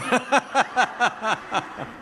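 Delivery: high-pitched laughing at himself; a bright burst of laughter. Real, not acted. Laughing at his nine-year-old self, high laugh.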